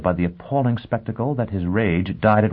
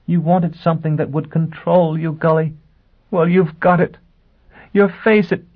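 much higher, louder voice, working up to hysterics; mounting hysteria; 10 semitones higher, 3 dB louder than usual. A narrator's reading. Woman taunting Gully about his face, mounting hysteria. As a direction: push it high, on the edge of hysterical laughter. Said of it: "began to laugh hysterically".

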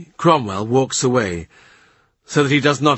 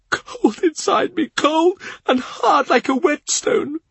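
much higher grieving servant's voice; tearful Cavendish; 13 semitones higher than usual. A narrator's reading. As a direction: lift it high like a tearful old retainer. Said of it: "He cries as he talks".

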